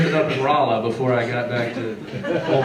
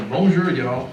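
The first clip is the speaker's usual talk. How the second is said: put-on French greeting in a strong Southern accent; 3 dB louder, slower than usual. French teacher greeting class, really Southern accent.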